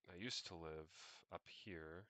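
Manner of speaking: usual speaking voice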